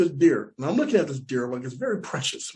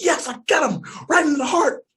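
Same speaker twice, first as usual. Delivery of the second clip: high, thrilled whoop of a hunter; gleeful hunter's brag; excited cheer; 10 semitones higher than usual. Retold or imitated. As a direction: crow about the kill. Hunter celebrates the shot.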